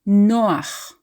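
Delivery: Noach with the weight on NO; Noach, NO stressed, ach light; stress on first syllable. Shown as a demonstration. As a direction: lean on NO in Noach, ch from the throat. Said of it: "we stress the second from last syllable".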